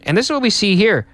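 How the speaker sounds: usual speaking voice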